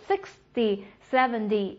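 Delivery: "sixty", "seventy" with strong starts and light "dee" endings; stress on first syllable. Shown as a demonstration. Teacher drilling tens, stress up front. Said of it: "重音都是哒哒".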